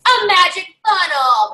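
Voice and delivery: high, loud, drawn-out announcement; showy, stretched-out fanfare; grand prize reveal. Acted. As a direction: unveil it like a game-show prize. Acted big reveal of the prize.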